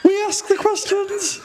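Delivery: high, slow comic Stott brother voice. Pretend. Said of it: "do it as one of Vic and Bob Stott's".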